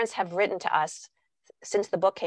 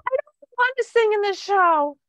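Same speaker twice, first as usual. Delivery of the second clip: put-on much higher, reluctant little girl; child voice; 13 semitones higher, 4 dB louder than usual. Child refusing to sing in the show, high and reluctant. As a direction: sound like a scared kid who won't go on stage.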